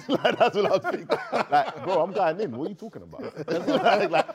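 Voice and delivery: loud, high laughing outburst. Real, not acted. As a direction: laugh while making the point. Laughing, heated point.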